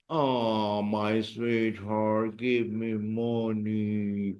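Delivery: soft, slow sweetheart plea; sweet request. Shown as a demonstration. Teacher sweetly begging for money. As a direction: coax it sweetly and slowly. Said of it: "How will you say".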